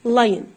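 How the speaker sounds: lion said "LIE-yn"; silent O. Shown as a demonstration. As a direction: run the I straight into the N of lion. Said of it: "باخد حرف ال I على ال N".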